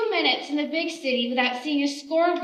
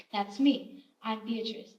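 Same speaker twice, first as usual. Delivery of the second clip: normal talking voice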